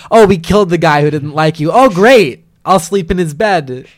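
mock-cheerful imagined reply; mock delight. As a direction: pretend it is wonderful news, hammed up.

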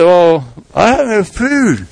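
slow, mournful sigh of a widow with nothing left; despairing widow. Acted. Widow tells the prophet she has no food, slow and mournful. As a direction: sound like the pantry is empty. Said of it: "And she said".

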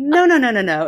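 loud, high laughing no-no-no; embarrassed protest. Retold or imitated. Shrugging off praise at the dinner table, loud and laughing.

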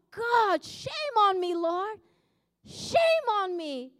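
retold repentant outcry; ashamed pleading, slowing at the end; remorseful cry. Crying out in repentance.

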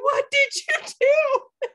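high, loud cry that breaks into laughter; slow panicked wail, laughing through tears; sob-laugh the question out; laughing crying shout. Retold or imitated. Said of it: "I was like".

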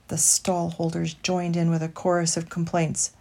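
ordinary delivery

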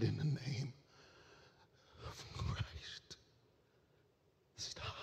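fading to a breathy, near-silent whisper; last words with the final breath; dying gasp. Acted. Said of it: "he gasped breath one last time".

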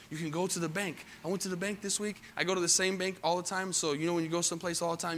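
ordinary talk, nothing marked

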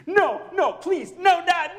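put-on high, loud pleading child's cry. Pastor voicing his child pleading with him, high and loud. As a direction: beg like a little kid. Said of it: "And I just melted".

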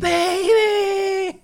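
put-on high whiny shouted Baby; whiny Baby call. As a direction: whine it out like an annoyed ex. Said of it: "it was a more whiny voice".

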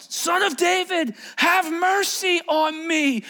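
high, long-held pleading shout; crying out. Acted. Blind man who has nothing to lose calls to Jesus, crying out. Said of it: "And he cries out".

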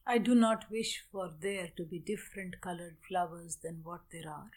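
ordinary talk, nothing marked

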